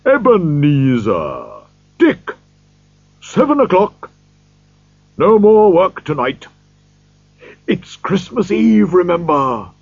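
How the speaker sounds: put-on high, jolly old gentleman's call; rich, happy calling. A narrator's reading. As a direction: call out heartily to the clerks. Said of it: "called out in a rich, deep, happy voice".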